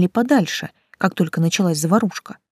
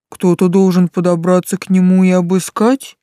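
booming; 6 dB louder than usual. A narrator's reading. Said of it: "прогудел Тецел".

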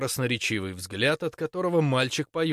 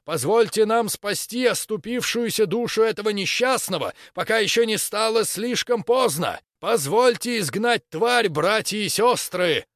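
fanatical preaching; 9 semitones higher, 5 dB louder than usual. Acted. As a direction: rouse the crowd like a fanatic. Preacher continues shouting to crowd.

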